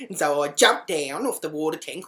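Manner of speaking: ordinary talk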